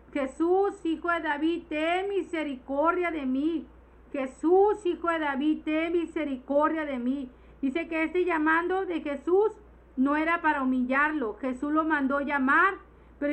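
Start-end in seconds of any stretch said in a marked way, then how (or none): none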